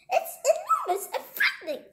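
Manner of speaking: high, loud squirrel voice; frightened squirrel. Acted. Squirrel trying bigger words on Sugar, frightened. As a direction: push it high in hammed-up fright.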